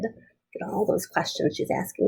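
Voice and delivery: soft unvoiced aside to the class; hushed aside. Real, not acted. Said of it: "teacher aside to class".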